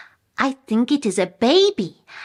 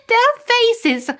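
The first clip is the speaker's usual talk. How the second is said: laughing. Real, not acted. Laughing at the neighbors' reaction.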